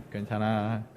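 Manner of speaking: low, soft reassurance; self-comforting. Shown as a demonstration. Those sitting alone telling themselves, soft reassurance. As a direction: hush your own worries gently. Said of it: "혼자 앉아 계시는 분 스스로한테".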